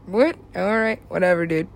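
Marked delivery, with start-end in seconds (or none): none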